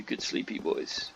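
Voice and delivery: a low, soft whisper to sleeping pets; soft soothing. Real, not acted. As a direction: hush them gently so they stay asleep.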